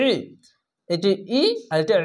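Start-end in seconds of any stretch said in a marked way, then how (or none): 0.1-0.1 ই pressed from the throat, thick; throat-squeezed ই. Shown as a demonstration. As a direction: squeeze the throat and make ই heavy. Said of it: "গলা চিপে মোটা করে পড়বে". (গলা চিপে মোটা ই)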